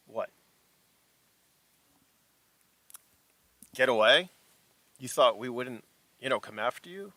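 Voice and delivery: taunting interrogator, pausing between phrases; emphatic, incredulous villain questioning; menacing corporate agent. Acted. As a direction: press them like a company enforcer. Game master plays corporate agent.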